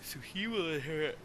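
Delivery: talking through a yawn; words stretched by a yawn. Real, not acted. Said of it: "I apologize for all the yawning".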